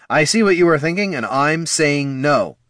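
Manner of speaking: high and loud, slowing on the no; refuse, upset and insistent; firm refusal. A narrator's reading. Bard to wounded friend, upset refusal.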